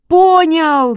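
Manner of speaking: loud, high cry of success; excited shout. A narrator's reading. Boy whose dog finally barked, shouting. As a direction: shout it out in triumph. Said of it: "закричал Шишкин".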